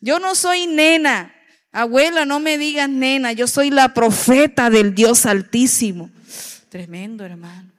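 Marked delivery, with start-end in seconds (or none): 0.0-6.1 with authority. Retold or imitated. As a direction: insist with full authority, the child correcting grandma. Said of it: "así con esa autoridad".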